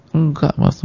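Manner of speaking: high, whimpering pretend crying; mock tearful denial. Crying wife denying her husband's guess, mock tearful. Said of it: "uh, nangis gitu".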